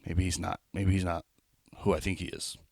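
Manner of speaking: soft, backing-down mutter; quiet second thoughts. Retold or imitated. Comic doubting himself after the guy's reply, quietly. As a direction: talk yourself down under your breath. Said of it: "I was like".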